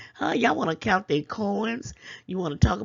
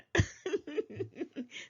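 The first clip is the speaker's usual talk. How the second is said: a quick high chuckle at the critics; 8 semitones higher, 8 dB quieter than usual. Real, not acted. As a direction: laugh softly, amused. Laughs at critics.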